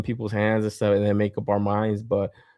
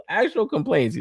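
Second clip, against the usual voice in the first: laughing; 10 semitones higher than usual. Real, not acted. Laughing at silly online complaints.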